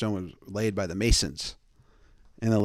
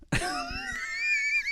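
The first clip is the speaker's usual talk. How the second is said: a squealing burst of laughter; high shrieking laugh; 6 dB quieter than usual. Real, not acted. Laughing at his own joke, high shrieking laugh.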